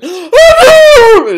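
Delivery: huge high shouted sneeze; terrifying sneeze. Retold or imitated. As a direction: blast out a sudden sneeze. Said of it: "it's terrifying".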